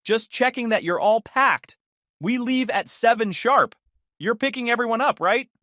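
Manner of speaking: bright sing-song cheerful mom; lightly voiced overcheerful mother; overly cheerful. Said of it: "her tone overly cheerful".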